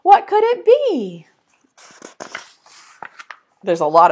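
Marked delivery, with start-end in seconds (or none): 0.0-1.3 higher, quick coaxing call; birds calling. Acted. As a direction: coax the egg open.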